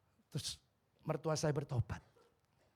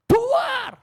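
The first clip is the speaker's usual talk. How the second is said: loud high boom of a crash; preacher imitating a bus smashing the church, loud; 15 dB louder than usual. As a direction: burst out with the bang.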